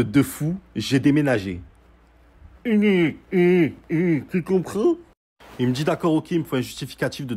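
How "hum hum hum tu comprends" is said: high, drawn-out teasing hum, then a slow question; smug, sing-song put-down; mock teasing. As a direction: rub it in like talking to a slow child. Mock-teasing the cop about moving.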